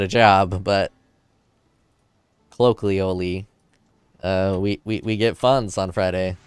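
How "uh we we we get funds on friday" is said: ordinary talk, nothing marked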